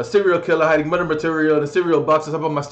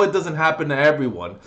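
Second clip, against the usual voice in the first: usual speaking voice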